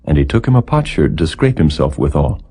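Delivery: ordinary delivery, nothing marked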